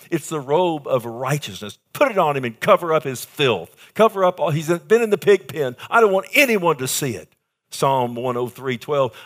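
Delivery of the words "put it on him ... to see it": urgent father's order. Retold or imitated. Father orders servants, pig pen shame.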